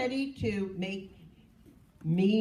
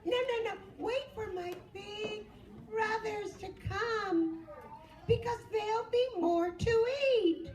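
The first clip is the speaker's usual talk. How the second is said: much higher tiny goat voice; little goat voice; 12 semitones higher than usual. Acted. Little goat talks the troll out of eating him, high. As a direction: squeak it out like a clever little goat. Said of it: "He knew exactly what to say".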